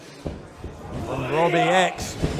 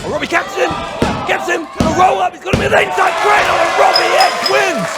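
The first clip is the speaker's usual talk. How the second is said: much higher, louder, racing to the win; excited finish call; 12 semitones higher, 6 dB louder than usual. Real, not acted. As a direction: call the pinfall at full pitch. Calling the winning pin.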